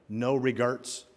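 "regrets" said as "regerts"; misspelled tattoo wording. Retold or imitated. Preacher quoting a misspelled tattoo, "regrets" said as "regerts". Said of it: "misspelled on his arm".

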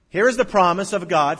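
preaching at full force. Acted. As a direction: proclaim it to the whole hall.

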